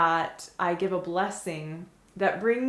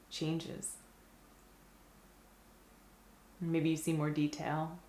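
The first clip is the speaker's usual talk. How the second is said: unhurried mindfulness guide, settling gradually quieter; guided meditation; 3 dB quieter than usual. Acted. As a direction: guide their attention gently, leaving silence after each phrase. Leads mindful looking at food.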